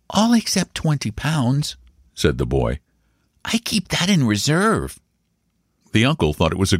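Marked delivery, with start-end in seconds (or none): 0.1-1.8 put-on high child's voice; small boy answering earnestly, pitched high; high-voiced little nephew; serious young boy. A narrator's reading. 3.4-5.0 put-on high child's voice; small boy explaining carefully, pitched high; high-voiced little nephew; serious young boy. A narrator's reading.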